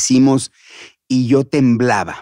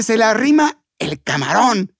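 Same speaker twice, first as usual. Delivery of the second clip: acted scolding; 8 semitones higher than usual. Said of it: "cuando se enojaba".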